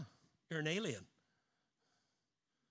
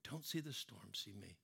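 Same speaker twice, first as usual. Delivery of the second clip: much softer, dropping away; soft repeat; 7 semitones lower, 10 dB quieter than usual. Real, not acted. Preacher echoing Jesus to his church, hushed. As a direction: let it fall to a hush.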